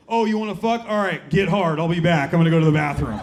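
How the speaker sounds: loud, businesslike mock orders; forceful, no-romance impersonation; aggressive girlfriend. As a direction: imitate a pushy partner barking instructions. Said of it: "but it's fucking aggressive".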